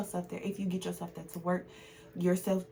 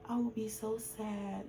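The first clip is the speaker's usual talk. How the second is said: soft, slow imitation of a young daughter; hushed retelling of her daughter; little daughter's reply; 3 semitones higher, 6 dB quieter, slower than usual. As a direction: quote a small child tenderly, voice lowered in volume. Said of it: "and she said".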